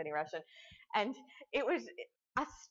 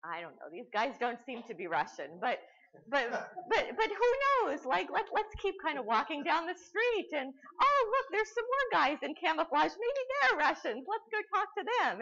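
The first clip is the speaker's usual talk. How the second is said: a baffled host cheering himself on; clueless host, rising; 10 semitones higher, 6 dB louder than usual. Retold or imitated. Lecturer mocking the host searching for soldiers, high baffled voice. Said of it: "And he's like".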